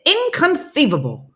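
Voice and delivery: high, loud stretched movie quote; hammed-up Vizzini inconceivable; Princess Bride inconceivable. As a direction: blurt it like the Princess Bride character. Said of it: "that's how I have to say it".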